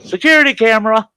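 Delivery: high, loud, quick taunt; gleeful gotcha. Retold or imitated. Resident pointing out camera to manager, gleeful retold taunt. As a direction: call it out high, on the edge of laughter.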